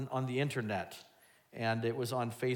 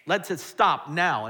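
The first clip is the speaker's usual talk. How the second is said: commanding God; 3 semitones higher, 8 dB louder, slower than usual. Retold or imitated. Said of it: "he'd be like".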